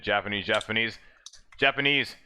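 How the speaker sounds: imitated commentary creeping up in pitch; voice rising a little. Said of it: "the voice goes up a little bit".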